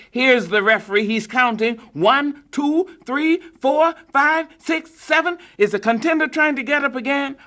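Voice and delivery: breathless radio count; counting the knockout. A narrator's reading. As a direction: count it out with rising excitement.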